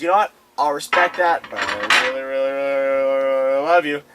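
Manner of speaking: loud mock lovesick pleading; lovesick plea. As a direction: ham up the lovesick suitor. Eugene voiced, pleading.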